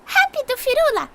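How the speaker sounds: tiny fairy cry, high and eager; shouting fairy. Acted. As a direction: squeak it out like an urgent little fairy. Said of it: "Sininho gritou".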